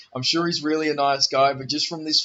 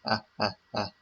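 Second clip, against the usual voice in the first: Count laugh; 4 semitones lower, 6 dB quieter than usual. Retold or imitated. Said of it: "the most laughter you'll ever see out of a Russian".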